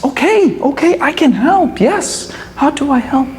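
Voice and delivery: high, quick-to-agree crane voice; eager helper crane. A narrator's reading. Crane answers the choking wolf, high and eager.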